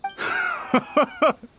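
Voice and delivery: a short high mocking laugh; scornful laugh. Official laughs scornfully at the commissioner's accusation, mocking laugh.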